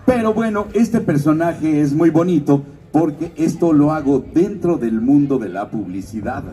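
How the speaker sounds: ordinary delivery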